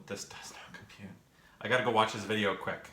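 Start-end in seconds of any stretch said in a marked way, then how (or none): 0.1-1.1 whispering. Real, not acted. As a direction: whisper "this does not compute" under the breath. Muttering the channel name twice.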